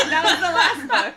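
high, loud, through laughter; can barely get it out laughing. Real, not acted. Cracking up reading.

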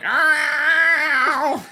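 high, loud laughing yowl like a cat in heat; hammed-up cat cry; mock cat-in-heat squeal. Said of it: "And then she's like".

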